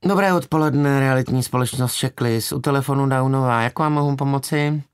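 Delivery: high-pitched, bored phone receptionist; utterly bored switchboard voice; bored young woman. A narrator's reading. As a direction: drone the company greeting in a high voice. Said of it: "nemohl znít znuděněji".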